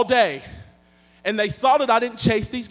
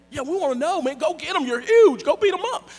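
put-on higher, excited teen voices; lightly voiced egging kids; 7 semitones higher, 3 dB louder than usual. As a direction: egg him on like kids.

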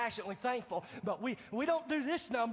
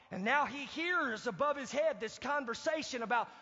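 normal talking voice

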